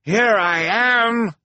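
high, long, loud call; triumphant shout. A narrator's reading. Handyman calling from the control basket, triumphant shout. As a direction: announce yourself triumphantly, stretched out. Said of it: "Hector's triumphant shout".

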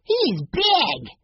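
put-on high, thrilled boyish voice; excited little boy. A narrator's reading. Little boy marveling at the peacock, high and thrilled. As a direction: marvel at the huge bird.

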